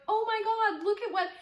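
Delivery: high, loud, fast gushing; freaking out. Retold or imitated. Texting her boyfriend about the gift, high excited gushing. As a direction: squeal it out in a rush. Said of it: "like, freaking out".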